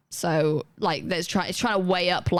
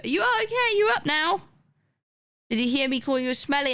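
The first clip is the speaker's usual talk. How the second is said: much higher, sing-song pet voice; cooing to the dog; 7 semitones higher than usual. Real, not acted. Talking sweetly to her dog.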